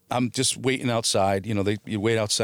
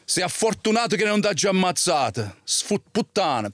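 retold Italian threat; 7 semitones higher than usual. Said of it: "Well, in Italian".

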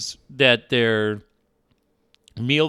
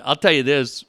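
usual speaking voice

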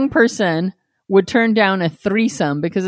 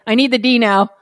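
mock orders, pushed louder and higher; imitated bossy demands; 4 semitones higher, 6 dB louder than usual. Co-host mimics Mew ordering partner around, bossy. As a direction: imitate someone calling all the shots. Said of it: "calling all the shots".

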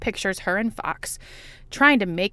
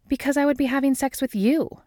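plain reading voice

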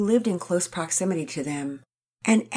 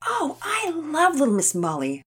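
put-on higher, delighted girl's voice; excitedly; 10 semitones higher than usual. A narrator's reading. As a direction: gush about a favourite game. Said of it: "Nora said excitedly".

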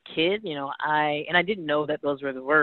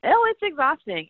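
emphatic agreement; 11 semitones higher than usual. Real, not acted.

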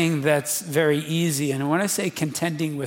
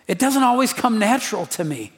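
ordinary delivery

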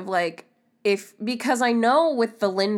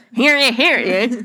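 mock radio announcer; 4 semitones higher, 8 dB louder than usual.

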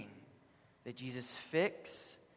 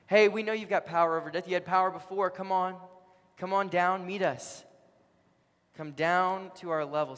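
loud, raised goading; challenging taunt; 5 semitones higher, 7 dB louder than usual. Retold or imitated. Crowd challenges Jesus to come down, taunting loudly. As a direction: egg him on like a jeering crowd. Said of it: "They were challenging him".

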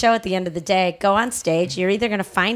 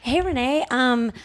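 eager fan; 6 semitones higher than usual. Retold or imitated. Fan asking for stand-up advice.

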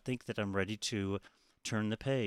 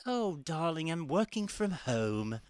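mock British, slow and a bit higher; posh British accent; 7 semitones higher, slower than usual. Imagining a cosy day with baking show on, posh British. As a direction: lightly play a posh British host. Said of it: "just be like".

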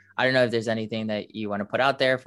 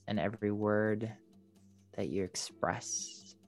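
slow, fading soft body scan; airy breathy mystical healer; 5 dB quieter, slower than usual. Acted. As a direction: drift softer down the body, slowly. Leads a meditation.

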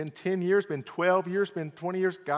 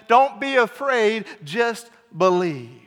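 slow emphatic; 7 semitones higher, 6 dB louder, slower than usual. Shown as a demonstration. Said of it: "slowed emphatic repeat".

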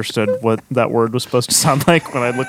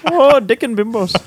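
slow, high, hammed-up Irish accent; bad Irish accent; 9 semitones higher than usual. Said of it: "Could you do that in a bad Irish accent?".

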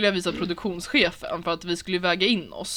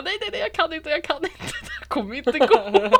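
high, panicky backing out with a laugh; panicked refusal; 10 semitones higher, 4 dB louder than usual. Retold or imitated. About to jump, high panicky laughing refusal. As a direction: lose your nerve right at the jump. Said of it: "jag bara".

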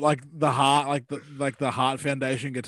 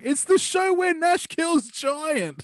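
high, drawn-out exclamation; high-pitched, stretched-out announcement; all caps; 11 semitones higher, slower than usual. Acted. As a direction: read it like it's typed in caps lock. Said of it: "my notes legit in caps lock".

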